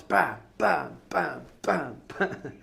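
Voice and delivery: punchy, evenly spaced "bam" hits; imitated smacking blows. Taekwondo master smacking students with pads, imitated thudding blows. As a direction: voice each blow as a sharp impact.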